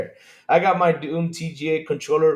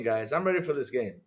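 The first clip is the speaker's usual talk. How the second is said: ordinary talk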